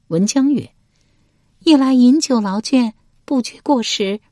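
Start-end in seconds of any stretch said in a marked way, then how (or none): 1.6-4.2 high female character voice; high-pitched lady answering her husband; voiced noblewoman. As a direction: answer in a high, put-on lady's voice.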